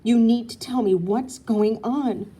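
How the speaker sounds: loud, slow furious words; angry hiss. A narrator's reading. Wife confronting her husband, loud slow hiss. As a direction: bite each word out at him. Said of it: "she practically hisses".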